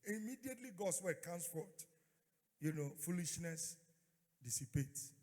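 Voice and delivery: ordinary delivery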